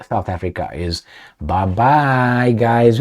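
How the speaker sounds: ordinary delivery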